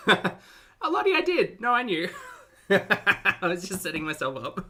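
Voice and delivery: laughing through the words; giggling at his own setup. Real, not acted.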